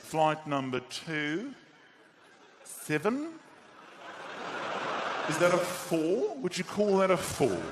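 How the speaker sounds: slow, halting airport announcer; zero urgency. Acted. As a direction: dawdle through the flight announcement with long pauses. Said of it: "I've never heard less urgency".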